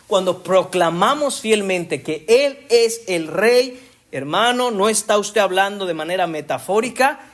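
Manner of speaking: ordinary talk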